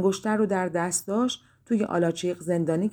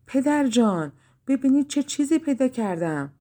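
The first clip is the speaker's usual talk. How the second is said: higher, eager call to father; excited call; 6 semitones higher than usual. A narrator's reading. Daughter calls her father over to see the ring, excited. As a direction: show off the treasure eagerly. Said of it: "دخترش فریاد زد".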